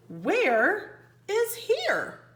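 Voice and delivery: slow, high, drawn-out wonder; wondering question. Acted. Teacher reading a title question to the class, slow and wondering. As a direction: stretch it out like a puzzled child.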